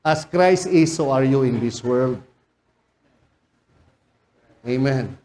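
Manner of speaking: usual speaking voice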